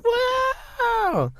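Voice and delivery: very high mock crowd cheer. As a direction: imitate a distant roaring audience. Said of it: "Everyone goes".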